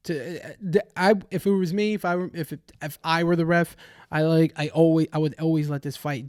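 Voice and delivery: usual speaking voice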